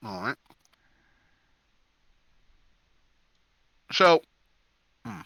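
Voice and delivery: talking while chewing; words around a mouthful of food. Real, not acted. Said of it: "Sorry, I'm chewing".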